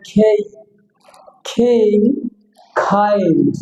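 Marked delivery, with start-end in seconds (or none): 0.1-1.9 "খেই" with aspirated k and ay glide; correct letter K. Shown as a demonstration. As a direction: puff the k of "খেই", then glide into i. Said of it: "কে সঠিক উচ্চারণ হবে".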